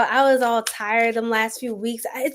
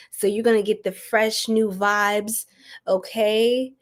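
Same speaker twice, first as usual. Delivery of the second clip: normal talking voice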